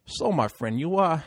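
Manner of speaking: put-on amateur Puerto Rican accent, sizing someone up; bad Puerto Rican accent. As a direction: imitate a driver with a rough Puerto Rican accent. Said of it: "I'm gonna do it bad accent".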